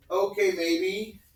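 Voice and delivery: put-on slow, high kid voice; imitated stubborn child starting to crack; wavering little brother. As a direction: give in grudgingly, drawing it out.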